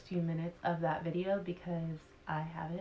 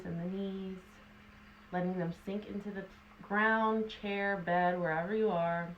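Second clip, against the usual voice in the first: calm guide easing tension away; guided meditation; 6 dB quieter than usual. Acted. Guided body relaxation meditation.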